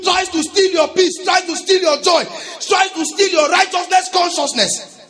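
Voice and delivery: high, loud, driving sermon delivery; preaching at full force. Real, not acted. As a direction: rouse the congregation to take authority.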